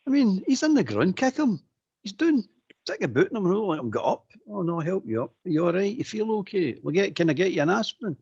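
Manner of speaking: scornful high mimicry of overly nice footballers; mocking high voice. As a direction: sneer at the players' politeness in a high put-on voice. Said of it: "you look at it and say to yourself".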